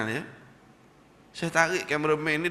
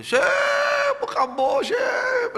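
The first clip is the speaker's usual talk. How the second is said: far higher, loud eager hello; excited greeting; 5 dB louder, much slower than usual. Retold or imitated. Former smoker hugging the Syekh, high excited greeting. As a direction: call out with delight.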